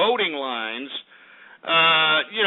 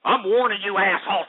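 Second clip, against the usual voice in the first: ordinary delivery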